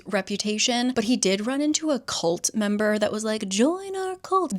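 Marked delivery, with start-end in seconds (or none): 3.5-4.6 much higher, slow eerie invitation; creepy cultist. Retold or imitated. Cult member inviting Kit to join, high and slow. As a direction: lure them in slowly and eerily.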